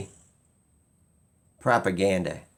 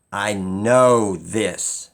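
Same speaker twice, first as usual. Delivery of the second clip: I know this, slow, with know drawn out and louder; stress on know; 4 dB louder, much slower than usual. Real, not acted. Insisting to viewers that he knows, know stressed slow. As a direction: lean hard and slow on know.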